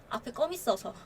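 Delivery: quiet, sheepish excuse; an understated shy excuse. Retold or imitated. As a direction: mumble the lame excuse softly. Guy's lame excuse, quiet.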